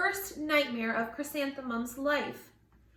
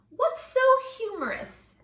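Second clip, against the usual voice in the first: high, loud, bright query; teacher's question; 10 semitones higher, 7 dB louder than usual. Acted. Music teacher asking the giggling students what is funny, high and bright. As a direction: ask the giggling class brightly.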